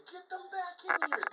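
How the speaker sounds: high, fast, excited command; retold frantic rallying call; urgent order. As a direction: call it out in a rush. Urgent order as scouts flee, retold.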